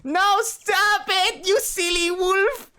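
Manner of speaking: high, loud, very slow squealing plea; playful shrieking struggle; laughing protest. Acted. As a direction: squeal at him to stop. Struggling in wolf's arms.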